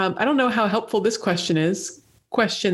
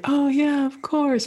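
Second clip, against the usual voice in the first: higher-pitched "it all comes back" reaction; mock sudden recognition; 7 semitones higher than usual. Player imagining hearing the trivia answer, mock sudden recognition. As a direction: act out remembering the obvious. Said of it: "I'm gonna be like".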